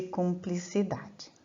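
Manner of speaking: measured, unhurried verse reading; poem recitation. Acted. Teacher recites a trova to the class, measured and poetic. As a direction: declaim the trova with care.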